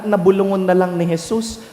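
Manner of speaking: ordinary delivery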